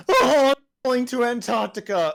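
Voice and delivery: high-pitched reenacted falling scream; mock panicked scream. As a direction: wail it high and loud, hamming up the panic. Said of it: "I would most likely scream".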